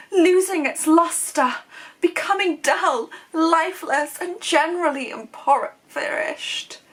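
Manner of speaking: loud, declaimed posh advert read; posh accent. Shown as a demonstration. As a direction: proclaim the vintage advert in a grand posh accent. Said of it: "such a posh accent for that, but I feel like it needs it for this".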